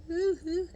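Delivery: high-pitched imitated boo-hoo wail; mock sobbing. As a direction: fake a whiny sob. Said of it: "she's crying, like, crying".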